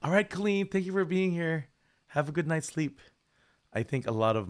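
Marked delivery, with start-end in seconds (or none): 0.0-3.0 higher, cheerful goodnight; bright farewell. Real, not acted. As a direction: wave a viewer off warmly. Saying goodnight to viewer.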